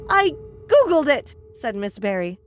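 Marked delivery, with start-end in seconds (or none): 0.1-1.3 loud, high-pitched comeback; exasperated outburst in a quarrel; heated retort. A narrator's reading. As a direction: throw it back at him, pitch way up. Lover snaps back in quarrel.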